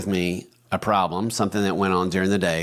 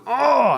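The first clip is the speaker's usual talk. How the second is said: high, louder exasperated "Oh"; frustrated groan; 6 dB louder than usual. Retold or imitated. Husband fed up with his broken sprinkler, exasperated high groan. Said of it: "I'm like".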